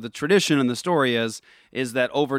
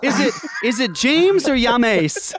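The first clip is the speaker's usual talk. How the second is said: laughing; 11 semitones higher, 4 dB louder, slower than usual. Real, not acted. Teasing name mispronunciation.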